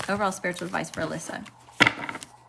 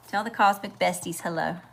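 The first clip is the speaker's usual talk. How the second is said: usual speaking voice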